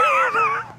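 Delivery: very high-pitched laugh after the joke. Real, not acted. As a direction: burst into a squealing laugh. Laughs after his bit.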